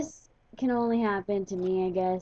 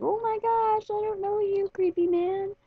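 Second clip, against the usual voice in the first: much higher, squeaky alarmed voice; mock shocked; 10 semitones higher than usual. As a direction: act out being weirded out by him. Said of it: "and I was like".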